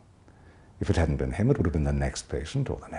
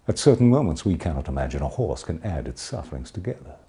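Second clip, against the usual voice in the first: ordinary talk, nothing marked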